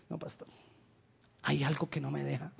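hesitant excuse. Retold or imitated. A brother excuses himself to the pastor, softly reluctant. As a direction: hold back, ashamed to admit it.

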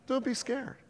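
high, softened placating voice; mock soothing. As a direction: coo it as useless comfort. Mocks an unhelpful soothing response.

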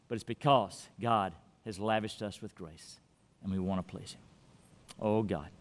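low, soft and reverent; hushed, earnest pastoral voice; quiet closing prayer. Real, not acted. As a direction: speak softly to the flock, then to God.

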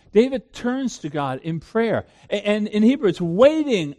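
normal talking voice

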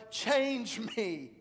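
loud, high plea to God; first cry of change me; a preacher voicing a disciple's prayer, loud.

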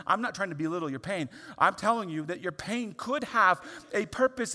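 loud, high, fervent preaching. Real, not acted. Pastor preaching loudly to the congregation about pain. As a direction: preach it out with full force.

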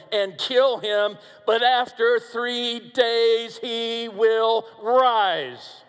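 drawn out, each word slowed and weighted; slow build. Acted. Preacher slowly building to Jesus's resurrection promise. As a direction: stretch "he will rise" very slowly.

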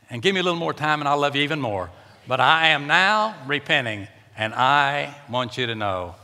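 ordinary talk, nothing marked

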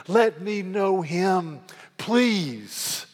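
slow, earnest, pleading prayer; heartfelt plea. Real, not acted. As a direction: draw the plea out gently, like asking God. Preacher's own closing plea.

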